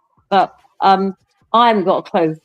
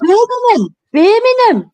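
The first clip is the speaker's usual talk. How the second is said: high scandalised repeat; mock outrage; incredulous; 13 semitones higher, 5 dB louder, slower than usual. Real, not acted. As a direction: squeal the city name in disbelief. Rejecting a wrong guess.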